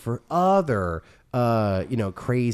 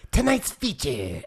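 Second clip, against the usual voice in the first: raised, slow ghoulish host voice; Crypt Keeper voice; 5 semitones higher, 4 dB louder, slower than usual. Pretend. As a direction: announce it like a creepy horror host. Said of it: "I was like".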